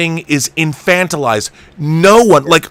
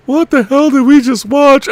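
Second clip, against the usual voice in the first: put-on girlfriend quote; 10 semitones higher, 4 dB louder than usual. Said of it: "she was like".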